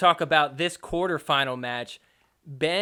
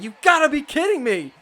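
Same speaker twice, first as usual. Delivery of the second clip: higher, louder mock complaint; mock exasperation; 8 semitones higher, 5 dB louder, faster than usual. Narrator voicing a furious player, higher and exasperated. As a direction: whine it like a sore loser. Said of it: "Fed is just like".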